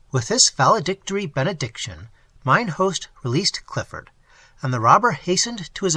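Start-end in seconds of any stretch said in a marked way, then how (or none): none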